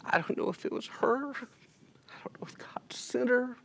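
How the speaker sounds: fighting back tears as he speaks; tearful. Real, not acted. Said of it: "I apologize for crying".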